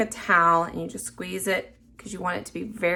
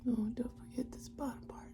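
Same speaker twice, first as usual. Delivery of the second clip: whispered worry, much softer; 17 dB quieter, faster than usual. Real, not acted. Whispering her doubt to viewers mid-blend. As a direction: whisper it, half to yourself. Focused, talking to self.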